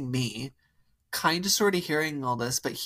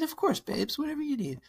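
much higher, sugary mock-eager voice; sweet eager reply; 13 semitones higher than usual. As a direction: chirp it up high like an overly sweet girlfriend. Her sweet eager reply quoted.

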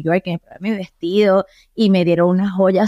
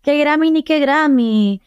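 higher, disbelieving protest; incredulous scoff; 7 semitones higher than usual. Retold or imitated. To her producers about the Grammys: high incredulous scoff. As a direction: brush off the idea as absurd, pitched up. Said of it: "Y yo".

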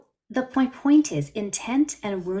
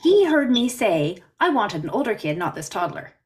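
emphatic; 7 dB louder than usual. Retold or imitated. Child overhears painful remark.

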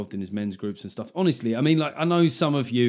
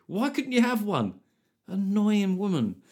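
much higher, slow indignant whine; mock outrage; 8 semitones higher, slower than usual. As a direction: play up the injustice, dragging it out. Outrage at pie seller.

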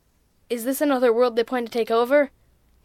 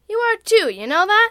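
bright, higher, smiling tease; confident grin; 10 semitones higher, 5 dB louder than usual. A narrator's reading. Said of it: "with a confident grin".

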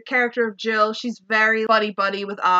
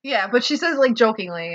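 ordinary delivery

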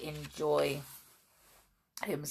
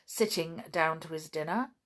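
usual speaking voice

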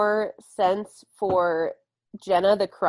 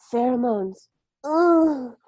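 mock-seductive word rising into a moan; sultry teacher; 4 semitones higher, much slower than usual. Teacher taking pheromones out, sultry. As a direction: imitate a teacher being sexy on purpose. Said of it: "taking pheromones out and going".

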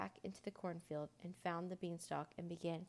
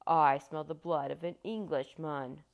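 very loud, menacing giant voice; thunderous storybook giant; booming giant; 3 semitones lower, 11 dB louder than usual. A narrator's reading. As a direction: boom it out like a huge giant. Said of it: "boomed the voice".

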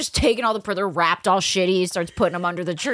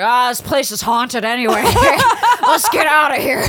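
put-on louder, laughing boyfriend voice; laughing excuse; 6 semitones higher, 8 dB louder than usual. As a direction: play it off with a laugh.

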